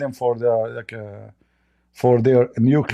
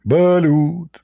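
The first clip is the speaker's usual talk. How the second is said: vendor call; 4 semitones higher, 3 dB louder than usual. Retold or imitated. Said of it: "give this Abdul some balut".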